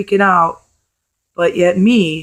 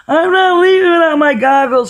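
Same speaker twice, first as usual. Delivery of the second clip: imitating crying kid; 9 semitones higher than usual. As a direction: play the kid refusing to leave the water, wailing. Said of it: "screaming and crying".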